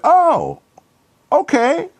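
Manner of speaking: high, loud imitated "oh, okay"; a hammed-up boss's surprised "oh, okay"; mock surprise. Martha reacting in retold story.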